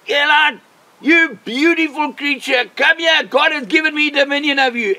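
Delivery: high, loud call across the distance; shouting. Acted. Man shouting to a wild buck, high loud shout. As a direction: call out commandingly, drawing words out. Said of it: "And I shouted again".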